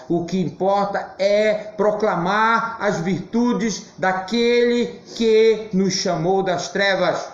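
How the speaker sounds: pregação lenta, em tom elevado; proclamação enfática. Real, not acted. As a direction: proclame devagar, palavra por palavra, com solenidade. Proclama o chamado divino, pregando. (proclamando com ênfase, pausadamente)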